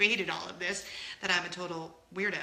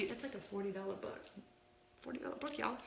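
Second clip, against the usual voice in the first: whispered aside; 13 dB quieter, faster than usual. Real, not acted. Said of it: "aside to viewers".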